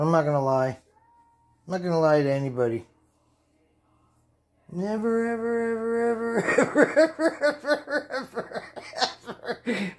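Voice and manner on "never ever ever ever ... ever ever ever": laughing through the words. Real, not acted. Drunk, giggling, stretching out never.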